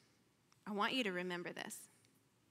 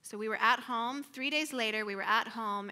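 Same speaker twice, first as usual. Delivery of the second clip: ordinary talk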